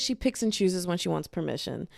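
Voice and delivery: ordinary talk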